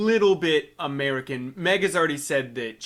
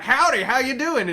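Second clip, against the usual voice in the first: mock Texas accent; 10 semitones higher, 7 dB louder than usual. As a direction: ham up a cowboy greeting. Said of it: "or it's like".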